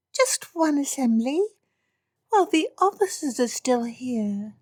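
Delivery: put-on high, pleading teenage girl voice; a spoilt girl wheedling her father; girlish whine. Spoilt daughter begs Papa for a ball, high girlish whine.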